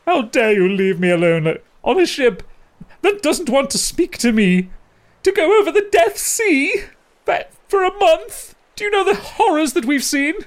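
very high, loud, wailing complaint; dramatic octopus rant. Acted. Orapan ranting at Calbo about the Death Sea, very high and loud. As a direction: rant up in a squeaky falsetto, stretching the end.

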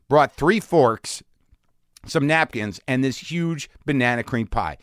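ordinary talk

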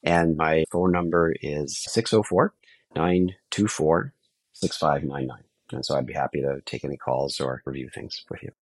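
normal talking voice

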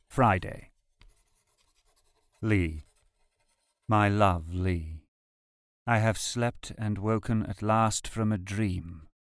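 ordinary talk, nothing marked

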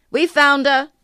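loud, high-pitched call into the distance. A narrator's reading. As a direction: announce it to someone far off. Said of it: "said in the direction of the torch".

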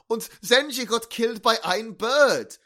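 mock German accent, dragging out "Und" and "ein"; bad German accent. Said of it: "stop doing the German accent".

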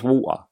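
local "water", its T left out; "water" said without T or R; dropped-T water. Shown as a demonstration. Defending the home way of speaking, "water" with dropped T. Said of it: "it's just how we speak where I'm from".

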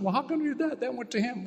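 a petty complaint voiced high; religious mind. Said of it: "that really upsets the religious mind".

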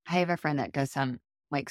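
usual speaking voice

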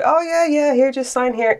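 high-pitched quoted reassurance; breezy store owner. Retold or imitated. Acting out the store owner's reply.

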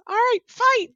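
tiny, very high, loud squeak; little pixie. Acted. Game master as pixie starting the fight, high squeaky call. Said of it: "the little pixie chick is like".

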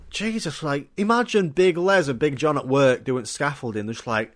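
normal talking voice

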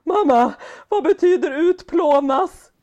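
put-on high, loud, slow child's voice; imitating her son, high and drawn out; son's question. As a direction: voice the worried little boy, drawn out. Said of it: "så säger han till mig så här".

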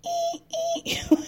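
high, squealing "eee" repeated in stabs; imitated horror sound. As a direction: squeal short, evenly spaced high notes. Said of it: "she looks around like".

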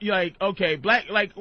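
usual speaking voice

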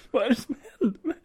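high, fast tearful babble; hammed-up sobbing voice; mock crying. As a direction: whimper it in pretend panic. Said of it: "Och gråter".

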